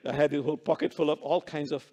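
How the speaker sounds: ordinary talk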